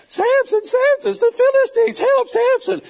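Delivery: mock alarm in a high woman's voice. Delilah fakes alarm to Samson, a high loud mock shriek.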